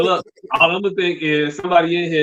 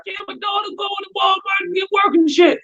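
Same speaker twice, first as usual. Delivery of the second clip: high mock voice. Mocking a mom's Facebook post.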